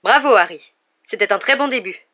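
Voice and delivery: loud, hearty congratulations; exclaimed praise. A narrator's reading. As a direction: cheer the pupil on. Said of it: "s'exclama Lupin". (félicitations enthousiastes)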